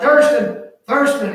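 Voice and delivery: calling out. Retold or imitated. Dad calls loudly for his missing son. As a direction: call his name loud across the house.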